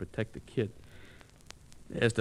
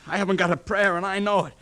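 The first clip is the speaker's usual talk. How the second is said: higher, louder despairing cry; hopeless outburst; 8 semitones higher, 5 dB louder than usual. Acted. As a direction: insist he's doomed. Bob refusing to surrender.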